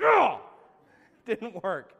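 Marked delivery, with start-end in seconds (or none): 0.1-0.4 loud, high, falling "ugh"; dismayed groan. Acted. Man spotted by someone he knew, loud dismayed groan. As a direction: burst out with a mock groan of dismay.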